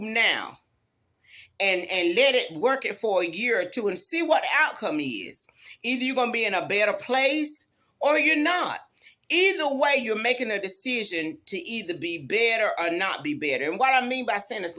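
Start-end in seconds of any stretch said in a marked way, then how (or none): none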